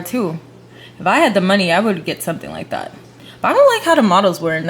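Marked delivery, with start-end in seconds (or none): none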